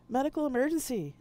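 loud high call for help. Acted. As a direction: call urgently into the comm. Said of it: "I called out".

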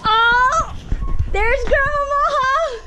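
high, drawn-out happy greeting; excited call. Real, not acted. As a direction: call out to her with delight. Greeting mom arriving, calling out.

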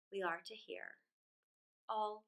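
ordinary delivery

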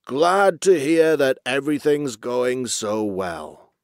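slow, loud, self-satisfied boss voice; pompous old chief. A narrator's reading. Old walrus chief fooled by Basil's good report, pompous satisfaction. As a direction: savour it like a contented old leader.

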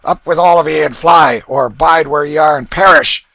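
loud, high hoarse order; hoarse command. A narrator's reading. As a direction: rouse the family loudly. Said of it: "hoarsely commanded".

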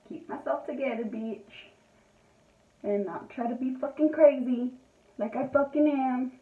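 usual speaking voice

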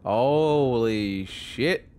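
normal talking voice

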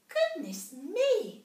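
high, slow squeaky mouse; a tiny mouse gasping in wonder; little mouse voice. A narrator's reading.

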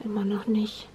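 soft unvoiced whisper. Real, not acted. Aside to the baby.

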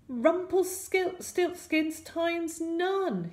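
high, drawn-out shout; shouted spell. A narrator's reading. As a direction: shout the spell slowly at the villain. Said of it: "he shouted".

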